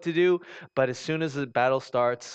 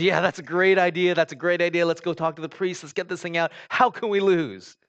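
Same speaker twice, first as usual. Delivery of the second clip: loud, high eager cheering soldiers; rowdy soldiers cheering, sure they can't lose; 4 semitones higher, 6 dB louder than usual. Acted. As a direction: hype up the plan like excited soldiers. Soldiers cheering the ark plan.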